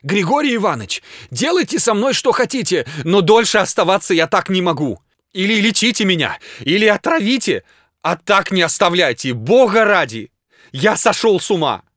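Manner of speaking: high, loud frantic pleading; breathless desperation. A narrator's reading. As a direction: beg the doctor as if at your wits' end. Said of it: "задыхаясь и вытирая рукавом пот".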